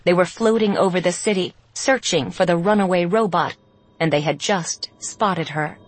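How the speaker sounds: ordinary delivery, nothing marked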